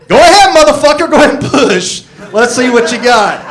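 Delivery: high, loud taunting yell; yelling ass. Retold or imitated. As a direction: bellow a dare at yourself like a bully. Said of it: "my ass starts yelling at me".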